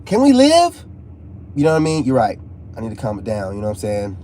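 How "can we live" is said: a hammed-up cry of can we live; mock plea. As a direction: beg in a pleading mock voice. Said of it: "it's just like".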